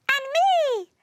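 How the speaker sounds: very high, loud little voice; squeaky plea. A narrator's reading. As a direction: pipe up to be noticed. Ignored Neptune butting in.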